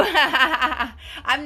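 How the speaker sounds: laughing through the words; slow, giggly brush-off. Real, not acted. Laughs off a compliment.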